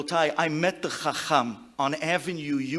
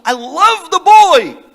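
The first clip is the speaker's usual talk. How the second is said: slow, loud, high insistence; earnest declaration; 11 semitones higher, 17 dB louder, slower than usual. Retold or imitated. Teacher insisting to grandfather, slow loud earnest. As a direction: proclaim it slowly and loudly. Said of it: "Chacham, I'm not joking, really".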